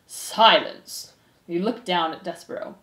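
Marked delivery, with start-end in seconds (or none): 0.1-1.1 loud, commanding follow-up; roared. Acted. Head mouse quells the crowd, roared. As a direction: command the room with finality.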